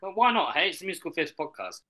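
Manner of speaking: usual speaking voice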